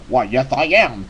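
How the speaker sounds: mimicked low cowboy voice; John Wayne. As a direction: repeat it as the deep-voiced cowboy. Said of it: "he said it just like that".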